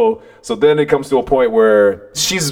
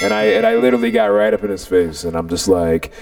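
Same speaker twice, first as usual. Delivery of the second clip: ordinary delivery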